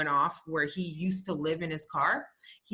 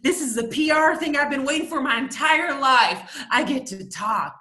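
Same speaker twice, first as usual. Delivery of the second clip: higher, louder gleeful self-satisfied mayor; mayor impression; 5 semitones higher, 4 dB louder than usual. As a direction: play the mayor thrilled to be on air. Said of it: "he's like".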